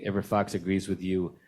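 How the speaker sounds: usual speaking voice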